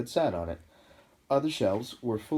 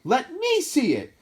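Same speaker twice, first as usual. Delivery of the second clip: high, loud, slow demand; 10 dB louder, slower than usual. A narrator's reading. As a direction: demand it like a spoilt boy. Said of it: "demanded Dudley".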